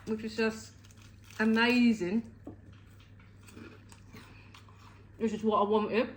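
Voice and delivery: talking through a mouthful; chewing while talking, mouth full. Real, not acted. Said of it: "Sorry to talk with my mouth full".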